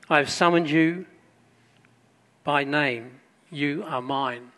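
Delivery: solemn Bible reading, strong on "do not fear"; scripture reading. Acted. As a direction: proclaim it from the pulpit, then let the last line settle. Reads Isaiah forty-three aloud.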